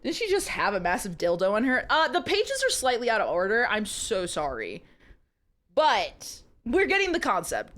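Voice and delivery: usual speaking voice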